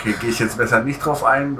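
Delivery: ordinary talk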